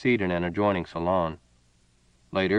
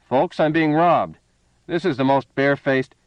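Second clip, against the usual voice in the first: raised voice; 6 semitones higher, 6 dB louder than usual. A narrator's reading. Gambler Seal calling to the crowd, loud. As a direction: shout to the room for help. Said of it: "Seal raised his voice".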